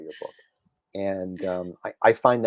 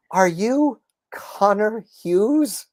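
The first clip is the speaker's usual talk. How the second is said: high, slow, wondering question; imitating a starstruck older fan; awestruck fan; 10 semitones higher, 4 dB louder, much slower than usual. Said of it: "she goes".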